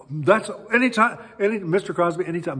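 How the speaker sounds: high, loud, tumbling reassurance; starstruck gushing. Retold or imitated. Lady answering [name]'s thanks, starstruck gushing. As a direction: gush at your idol, tripping over words.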